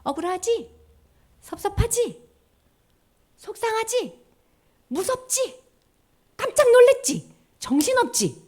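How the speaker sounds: high, needling tempter voice; taunting Satan. Acted. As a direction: tease the child like a sly tempter. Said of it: "사탄이 우리한테 맨날 이렇게 하는 거야". (사탄이 아이들을 놀리듯 높은 목소리로 꼬드김)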